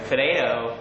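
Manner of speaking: American "puh-day-do"; American accent. Shown as a demonstration. Brit trying a requested word, American accent. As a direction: flap the T's like an American. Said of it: "my best American accent".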